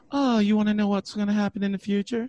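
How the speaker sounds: psychic lady voice. Retold or imitated. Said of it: "she's like".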